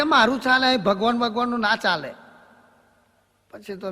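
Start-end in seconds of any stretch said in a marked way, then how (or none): 0.0-2.1 proud village head bragging his rule. Retold or imitated. Village head insists on his power.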